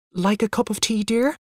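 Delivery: high, tentative rising question; nervous. A narrator's reading. Mother offers father tea on waking, nervously. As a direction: offer it anxiously, trying to placate. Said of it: "asked Mother nervously".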